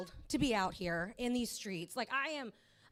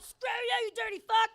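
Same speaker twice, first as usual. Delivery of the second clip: mock shout, higher and louder; imagined shout; 10 semitones higher, 6 dB louder than usual. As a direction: yell it at the imagined cop. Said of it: "I'd have been like".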